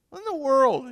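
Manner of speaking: high, loud exclamation of disbelief; incredulous burglar. Acted. Burglar to a talking bird, loudly incredulous. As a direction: burst out in acted astonishment.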